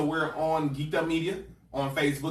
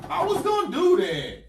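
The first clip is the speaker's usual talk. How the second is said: high protest; 7 dB louder than usual. Real, not acted. Cohost cries out in protest.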